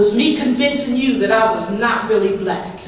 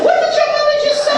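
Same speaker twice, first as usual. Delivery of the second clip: much higher, shocked disbelief. Retold or imitated. Teenage girl asking her friend, much higher, in shock. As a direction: squeak it out in disbelief. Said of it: "I said".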